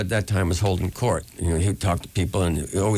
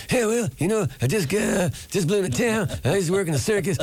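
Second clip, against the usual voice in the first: much higher, playful mock young folk singer; 12 semitones higher than usual. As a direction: spin a tall tale in a high put-on voice. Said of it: "he's going".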